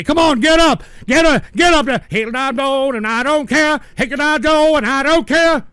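high, loud jeering chant; mocking taunt. Host imagining a heckler taunting people, mocking shout. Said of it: "dance around fucking people in wheelchairs and say".

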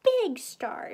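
high, loud burst; shouting. A narrator's reading. Animal seeing the star, shouting. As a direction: shout it out, hitting the first word. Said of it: "shouted Animal".

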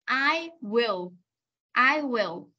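"I will" as two separate words; full form. Shown as a demonstration. Teacher spelling out what I'll stands for, full form. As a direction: say "I" and "will" apart, uncontracted. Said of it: "nó viết tắt của".

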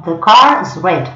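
slow, careful read, each word apart; slow model sentences; slow English demo. Shown as a demonstration. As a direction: model the sentences slowly for learners. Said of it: "En inglés siempre es igual".

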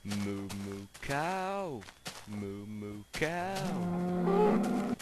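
playful moo at the cows; mock cow. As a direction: moo at them for fun. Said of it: "now there are cows".

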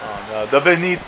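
usual speaking voice

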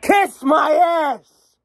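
loud, drawn-out shout; slow angry shout. Real, not acted. Telling off New Jersey's government, a slow loud shout. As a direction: bellow it slowly, each word hit.

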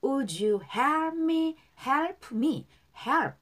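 slow, separated practice words; feed the phrase piece by piece for echoing; repeat-after-me drill. Shown as a demonstration. Teacher drills learners on the line, slow and segmented. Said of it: "자, 따라할게요".

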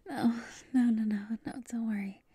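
normal talking voice

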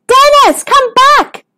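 very high, urgent call. Acted. Stanley calls his runaway cousin back, high urgent call. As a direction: call after someone drifting away. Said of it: "called Stanley".

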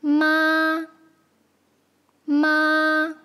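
妈 in a level first tone; drawn-out 妈 with no rise or fall; tone demonstration. Shown as a demonstration. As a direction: hold 妈 long on one steady pitch. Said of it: "Tell us the tone of each".

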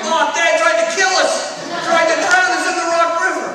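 put-on kids tattling. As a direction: yell to Mom as an outraged child.

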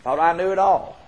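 usual speaking voice

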